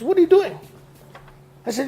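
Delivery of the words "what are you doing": sharp, high, raised "What are you doing?"; a coworker's startled, high call; German coworker. Retold or imitated. German boss stops the saw, sharp and high. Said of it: "German guy goes".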